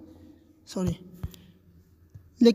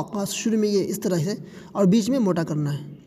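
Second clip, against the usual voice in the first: normal talking voice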